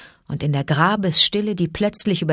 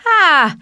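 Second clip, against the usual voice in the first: high, loud single "ha"; one amused high snake titter; snake's chuckle; 12 semitones higher, 6 dB louder than usual. A narrator's reading. Python amused by the cobras' complaint, tittering. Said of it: "kicherte K".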